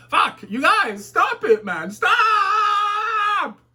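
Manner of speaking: high, loud wailing protest; mock-anguished shout. Reader begging commenters to stop, hammed-up anguished shout. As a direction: wail it hammed-up, stretching the last word into a scream.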